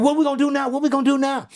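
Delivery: high, urgent repeated question; high-pitched retold pleading; retold anxious father. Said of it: "He said".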